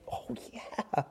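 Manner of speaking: hushed, voiceless recollection; dawning realisation. Acted. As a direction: let the memory dawn in a whisper. Acting the women suddenly remembering.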